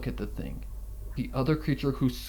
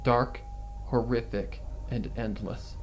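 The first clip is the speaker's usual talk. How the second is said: story narration; 5 dB louder than usual. A narrator's reading. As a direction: draw listeners into the nightmare. Reads horror fanfic aloud.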